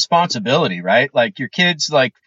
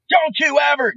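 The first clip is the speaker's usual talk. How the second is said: higher, stern scolding; stern warning; 8 semitones higher than usual. Shown as a demonstration. Showing what the mom should have said to her toddler, stern and higher-pitched. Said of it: "no tone of voice".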